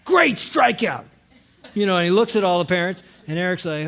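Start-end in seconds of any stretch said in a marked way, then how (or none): 0.0-1.1 high, drawn-out proud shout from the stands; booming acted-out uncle's cheer. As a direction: holler loud encouragement across the ballfield. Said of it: "he stands up and he goes".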